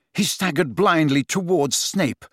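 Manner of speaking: usual speaking voice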